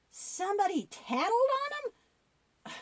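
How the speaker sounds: high, slow, shocked puppet voice; a puppet stunned that someone told on them. Acted. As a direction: gasp it out in disbelief.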